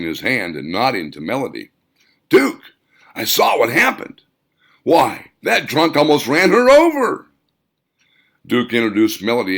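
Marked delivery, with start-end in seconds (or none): 2.3-7.3 much higher and louder, calling out eagerly; excited call. Acted. Assistant Rich calling out to Duke after the scuffle, excited. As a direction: hail your boss across the room, worked up. Friend calls out across room.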